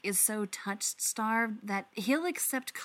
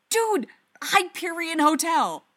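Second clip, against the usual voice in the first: loud, high thrilled exclamation; fanboy overjoyed at the sight; shouting at a friend, star-struck; excited shout; 8 semitones higher, 8 dB louder than usual. Acted. Stiles spots the famous hotel.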